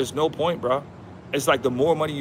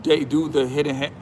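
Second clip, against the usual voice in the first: ordinary delivery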